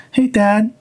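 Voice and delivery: high, meek little greeting; mock timid son greeting; timid kid voice. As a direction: squeak it out like a shy kid. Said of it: "like you're timid?".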